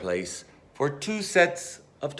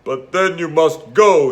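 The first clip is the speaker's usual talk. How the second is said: sniffling; 4 semitones higher, 9 dB louder than usual. Acted. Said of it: "he sniffled".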